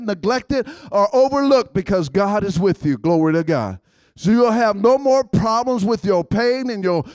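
fast, loud, excited sermon drive; fervent preaching. Acted. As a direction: rouse the congregation. Preaching at full force.

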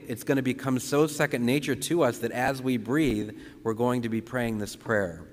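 ordinary talk

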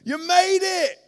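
high, loud jubilant cheering; dad cheering his son who made it; overjoyed dad. Retold or imitated. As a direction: celebrate like a proud dad. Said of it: "he's like".

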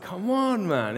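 God's voice jumps high in exasperation; an exasperated "come on". Retold or imitated. God losing patience with Moses, exasperated. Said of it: "God's anger burns against him".

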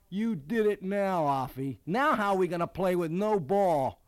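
mock-angry kid's complaint; annoyed teammate. A narrator's reading. Bobby scolding the batter who lost the ball, annoyed.

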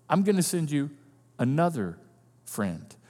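Jesus gently promising a friend; soft tender reveal. Shown as a demonstration. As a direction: let "another friend" fade to a murmur. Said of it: "just like me kind of friend".

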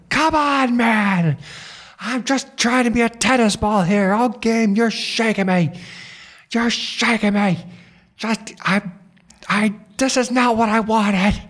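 high, loud exasperated pleading; desperate outburst. Retold or imitated. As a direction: plead at the top of your voice, worn down. Acted desperate plea to his tormentor.

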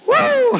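sudden high-pitched woo; high joyful whoop. Real, not acted. Preacher overcome after reciting the song, high whoop. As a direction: whoop out in praise.

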